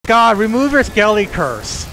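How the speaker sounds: loud, high gloating shout; raging gamer. Real, not acted. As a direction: yell it in triumph. Taunting a beaten player.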